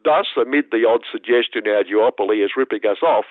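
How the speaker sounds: ordinary talk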